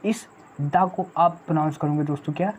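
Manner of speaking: ordinary talk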